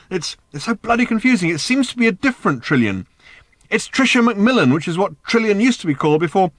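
high, loud agitated confusion; flustered. Acted. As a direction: call out in a bewildered fluster. Arthur confused, calling from next room.

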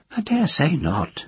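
put-on high, cracked old crone gossiping; nosy old village witch. A narrator's reading. The witch speaks.

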